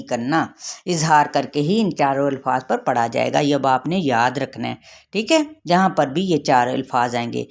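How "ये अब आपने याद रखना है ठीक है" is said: normal talking voice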